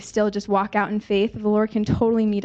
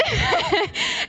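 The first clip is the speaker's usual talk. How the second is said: delighted laugh; 12 semitones higher than usual. Real, not acted. Narrator laughs at the healing.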